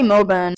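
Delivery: "Melbourne" as "Mel-bun", R silent; Melbourne without R. Shown as a demonstration. Australian explaining local usage, Melbourne with silent R. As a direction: leave the R out of "Melbourne". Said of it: "supposed to say it without the R".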